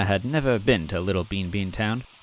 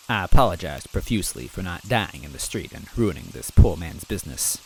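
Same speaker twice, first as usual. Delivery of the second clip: ordinary delivery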